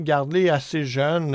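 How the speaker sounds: ordinary talk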